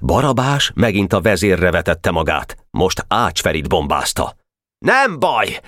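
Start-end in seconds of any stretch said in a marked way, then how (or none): 4.8-5.6 high-pitched defiant call; shouted cry. A narrator's reading. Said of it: "kiáltotta". (magas hangú, dacos kiáltás)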